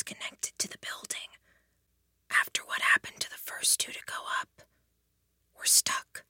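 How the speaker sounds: whisper. A narrator's reading. Helen whispering on the wall.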